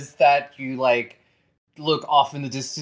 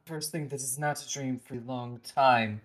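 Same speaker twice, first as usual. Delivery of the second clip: soft and tender; 8 dB quieter, faster than usual. Acted. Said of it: "he just goes".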